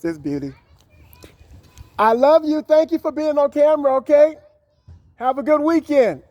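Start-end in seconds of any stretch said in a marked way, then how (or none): 2.0-6.2 much higher and louder, a sing-song goodbye; sweet farewell. Real, not acted. Saying goodbye to the child on camera, high and loving.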